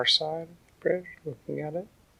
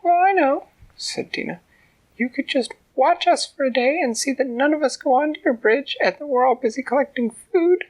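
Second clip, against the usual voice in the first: high, loud squeaky little ant; tiny ant voice; 5 dB louder than usual. A narrator's reading. Ant talking to the troll from inside his ear, high squeak. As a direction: speak up like a tiny determined ant.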